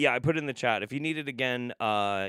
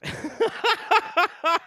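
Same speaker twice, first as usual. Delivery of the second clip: loud, high burst of laughter; real high cackling laugh; 6 dB louder than usual. Real, not acted. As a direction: crack up at your own joke. Laughs at his own accent joke.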